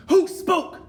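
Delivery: very high, very loud scream; shriek. Acted. Grand High Witch shrieking at the room in rage. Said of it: "she shrieked".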